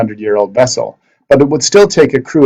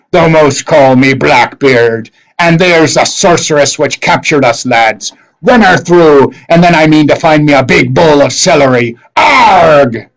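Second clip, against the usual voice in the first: loud, slow, hammed-up pirate voice, shouting orders; pirate captain Blackbeard; 4 semitones higher, 5 dB louder, slower than usual. Blackbeard urging his lads to attack the sorceress, loud pirate shout. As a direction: bark like a swaggering pirate captain.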